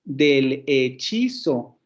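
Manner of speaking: "del hechizo" slowly, each syllable set apart. Shown as a demonstration. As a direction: measure out del, he, chi, zo. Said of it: "hacer otra vez la división silábica".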